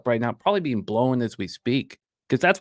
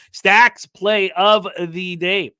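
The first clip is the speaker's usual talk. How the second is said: slow loud announcement; 6 semitones higher, 6 dB louder, much slower than usual. Acted. Host announcing a viewer's play of the day, slow and loud. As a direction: stretch it out big and loud, higher.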